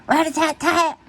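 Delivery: high lively rat-a-tat-tat; drum noise. Acted. Reader voices Gus's drumming beat. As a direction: tap out the drum beat with your voice.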